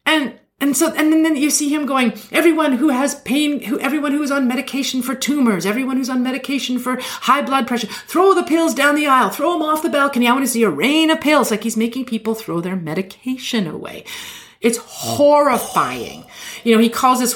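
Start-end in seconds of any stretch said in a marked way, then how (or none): 2.3-11.5 high, hammed-up faith-healer preaching; televangelist healing. Preacher casting the devil out of a woman's ears, hammed-up and high.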